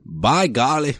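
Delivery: put-on Indiana accent. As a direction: mimic an Indiana local. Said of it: "That's what they say over there".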